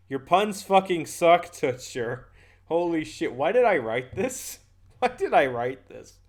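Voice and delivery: loud, slow disbelief; drawn-out, real exasperation; exasperated outburst. Real, not acted. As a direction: groan loudly at your own terrible writing. Exasperated at own pun script.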